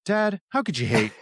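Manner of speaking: lightly voiced son questioning his dad; a touch of mock disbelief; movie son. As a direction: play the puzzled son, understated. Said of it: "the, the son".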